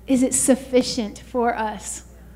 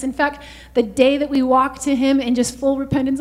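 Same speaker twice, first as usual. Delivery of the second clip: ordinary delivery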